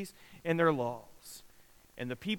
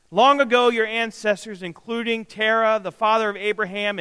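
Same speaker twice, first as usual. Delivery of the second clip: raised, declaiming scripture read; 3 semitones higher, 3 dB louder than usual. Acted. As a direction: proclaim God's words to the people. Preacher reads Joshua's words aloud.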